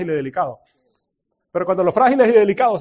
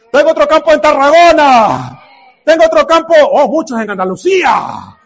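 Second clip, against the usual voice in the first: excited shout; 5 semitones higher, 8 dB louder than usual. Pretend. Playacting eager talk with God.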